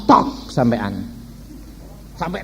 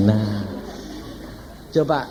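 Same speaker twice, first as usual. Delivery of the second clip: ordinary talk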